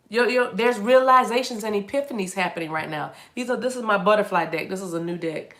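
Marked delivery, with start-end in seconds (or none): none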